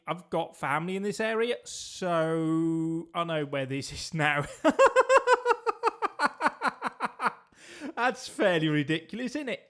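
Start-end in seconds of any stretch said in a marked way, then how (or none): 4.5-7.3 a burst of high cackling laughter; loud high laugh. Real, not acted. Laughing hard at the map to the viewers. Big laugh at own remark.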